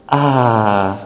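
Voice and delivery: ordinary delivery, nothing marked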